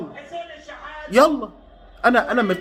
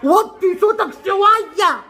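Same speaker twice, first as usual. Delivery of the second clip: exasperated pleading; 4 semitones higher than usual. Real, not acted. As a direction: start hurried, climb higher and stretch it out. Begs commentator to lower his voice.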